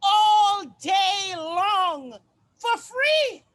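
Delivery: slow, loud wailing shout; anguished shout. Acted. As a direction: cry the line out slowly, at full voice. Monologue climax, shouted cry.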